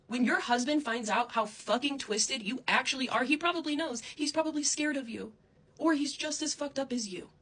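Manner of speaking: loud, high furious tirade; angry yelling. Real, not acted. Insulting Krissa's husband, yelling. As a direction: shout it at her. Angry rant at former friend.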